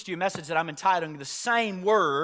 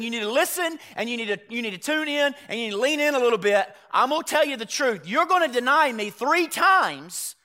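loud, raised-pitch preacher's warning; Jesus's blunt warning; 7 semitones higher, 7 dB louder than usual. Acted. Lord bottom-lining it for his disciple, loud and emphatic. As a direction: hammer it home, quickening, then slowing on the verdict.

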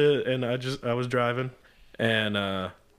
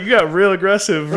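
laughing; 7 semitones higher, 9 dB louder than usual. Retold or imitated. Retelling reaction to pushy cop.

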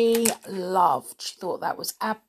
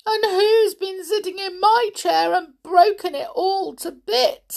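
high, loud little squeak of complaint; squeaky tearful baby bear; 13 semitones higher, 7 dB louder than usual. A narrator's reading. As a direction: wail it as an upset baby bear. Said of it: "squeaked the baby bear in tears".

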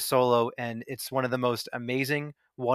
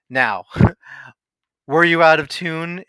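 mock yell, louder and higher on the question; raised-voice grilling, retold with a laugh; 4 semitones higher, 8 dB louder than usual. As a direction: imitate a bullying bandleader demanding an answer. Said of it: "he yells at him and goes".

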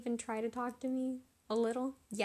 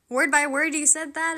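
loud, higher, disbelieving dad; a dad who can't believe his ears; surprised dad; 3 semitones higher, 9 dB louder than usual. Retold or imitated. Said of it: "he was surprised".

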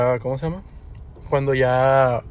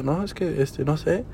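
small, much softer nervous reply; imitating a very quiet voice; 9 dB quieter, slower than usual. Flustered worker stalling the customer, very quietly. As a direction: drop right down to a timid murmur. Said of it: "empezó a hablar bien quedito".

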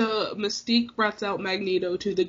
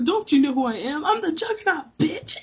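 Juggernaut yell; 7 semitones higher than usual. Retold or imitated. Fan quoting Juggernaut's line to Kitty, mock bellow. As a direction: ham up the Juggernaut's boast. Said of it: "he goes".

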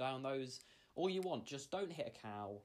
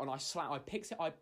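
ordinary talk, nothing marked

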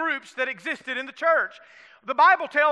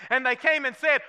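normal talking voice

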